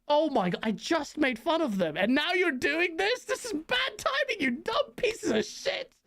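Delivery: a slow, high, incredulous mock rant; exasperated outburst. Reliving his reaction to the bad news, exasperated slow rising rant.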